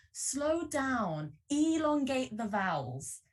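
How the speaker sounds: drama teacher. Retold or imitated. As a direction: stretch the vowels like a drama coach. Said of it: "that our teacher was saying to us".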